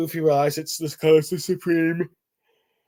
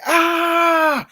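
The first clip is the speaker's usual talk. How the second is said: a sudden shrieking cry, much higher and louder; imitated high scream; 7 dB louder than usual. Imu reacting to the Conqueror's Haki, high shriek. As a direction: let out a piercing mock scream. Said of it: "Imu, who's going like".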